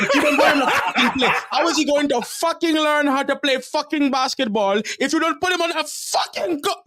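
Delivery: high-pitched, loud screaming impression of a furious father, laughing through it; imitated raging dad. Coach imitates the dad screaming at him about benching his son, high furious yell. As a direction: shriek it like an enraged parent losing it at the coach. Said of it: "ever been cursed out like that".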